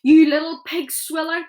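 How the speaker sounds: a spitting giant roaring abuse; furious roar. A narrator's reading. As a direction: blast the insult. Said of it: "roared the blood bottler".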